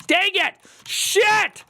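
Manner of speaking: high, loud angry curse; frustrated outburst. Real, not acted. Streamer swearing loudly as his code breaks. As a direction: yelp at the broken code.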